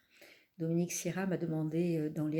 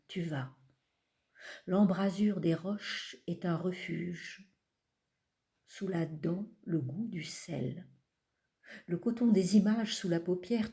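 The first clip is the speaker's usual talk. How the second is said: measured, unhurried recital with long pauses; poetry reading. Acted. Poet reading from her collection to an audience, measured recital. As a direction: let each image settle before the next. Reads poems aloud.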